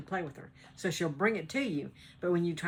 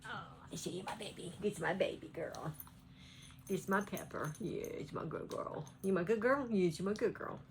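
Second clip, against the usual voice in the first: much softer, tender cooing to a pet; 6 dB quieter than usual. Real, not acted. Vlogger praising her poodle Pepper, softer tender baby talk.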